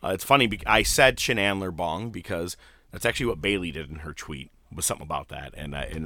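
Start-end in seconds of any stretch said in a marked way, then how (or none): none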